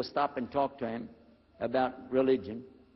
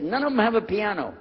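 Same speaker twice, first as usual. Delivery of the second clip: triumphant loud point; 6 semitones higher, 7 dB louder than usual. Retold or imitated.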